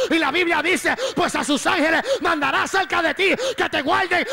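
loud preaching climbing to a high shout; full-force preaching. Real, not acted. Preacher quotes scripture to congregation, fervent rising shout. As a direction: build phrase by phrase to a shouted climax.

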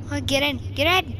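usual speaking voice

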